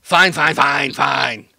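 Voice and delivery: loud, repeated grudging agreement; rapid-fire exasperated surrender; irritated giving-in. Acted. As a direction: concede in a huff. Giving in to the orders.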